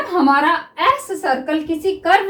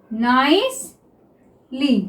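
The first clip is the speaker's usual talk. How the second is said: Officer drawn out very slowly; teacher dictating officer to students; slow dictation; 3 semitones lower, much slower than usual. Shown as a demonstration. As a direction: stretch officer as if writing it down. Dictating while writing shorthand.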